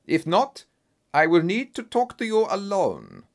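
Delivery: lightly voiced German nobleman, booming; a hint of a German accent, grand. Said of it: "in a strong German accent".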